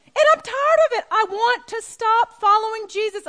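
from a tired complaint up to a high, loud, breaking cry; tearful outburst building. Retold or imitated. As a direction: let it climb until she's wailing at her husband. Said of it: "I was sobbing".

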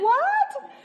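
a squealed, laughing "What?" in disbelief; shocked laughing. Retold or imitated. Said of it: "I just was shocked".

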